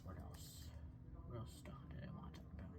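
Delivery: soft unvoiced musing; whispering. Real, not acted. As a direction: whisper to yourself, thinking. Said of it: "whispered musing".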